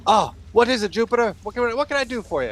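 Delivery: high, rapid-fire halfling chatter; fast, jittery. Acted. Halfling answers her boss's summons, fast and jittery. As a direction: rattle it off in a jittery cadence. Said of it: "talking this really, really fast, jittery, uh, cadence".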